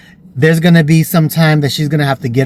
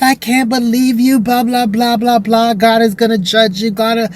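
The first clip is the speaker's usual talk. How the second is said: high-pitched imitation of an outraged mother; mock scolding; 7 semitones higher than usual. Mother tells her child God will judge, mock scolding. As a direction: rattle off a mother's rebuke in a raised pitch.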